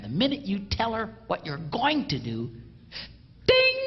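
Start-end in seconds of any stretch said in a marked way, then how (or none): 3.5-3.8 high, loud ringing ding; ding of a bell. Pretend. Comedian scoring the husband a point, loud bell ding. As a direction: sound the scoreboard bell.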